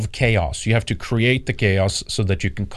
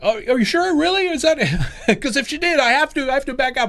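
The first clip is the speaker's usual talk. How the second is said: high, laughing mock disbelief; mock imitation; 13 semitones higher than usual. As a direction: squeak it out, half laughing. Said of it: "he's like".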